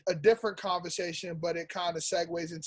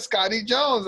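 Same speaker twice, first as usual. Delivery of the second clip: imitating a New York accent on "Scotty Jones"; mock New York accent; 4 semitones higher, 7 dB louder than usual. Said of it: "He said".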